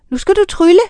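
high, eager little witch; high little witch urging. A narrator's reading. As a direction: voice a tiny excited witch, pitched high. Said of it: "sagde den lille heks".

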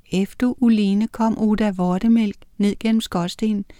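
plain reading voice

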